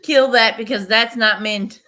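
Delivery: loud mock shout quoting a friend; loud retold order to kill the plant. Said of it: "Vermonster said".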